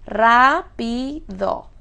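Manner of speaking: "rápido" slowly split as rá, pi, do; syllable by syllable. Shown as a demonstration. Teacher revisiting a word with tilde, "rápido" syllable by syllable. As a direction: sound out "rápido" with a strong RÁ. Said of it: "Y ya la tenemos con tilde".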